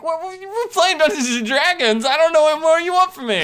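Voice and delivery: high, exasperated protest. Retold or imitated. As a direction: throw up your hands, high and pleading. Player retells exasperated reply.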